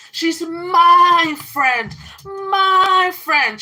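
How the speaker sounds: high, loud, drawn-out imitation of the friend; mock possessive shout. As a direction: shout it like she's claiming her friend, slow. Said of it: "when she said".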